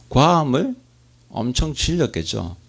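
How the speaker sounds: ordinary talk, nothing marked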